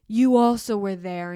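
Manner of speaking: a loud burst, then it drops straight back; retold flip-out. Snapping back loudly at mom over the lost receipt, mock outburst. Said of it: "and I flipped out, and I was like".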